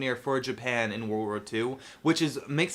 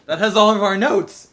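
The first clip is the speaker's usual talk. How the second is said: mock panic; 7 semitones higher, 10 dB louder than usual. Mock panic over lost notes.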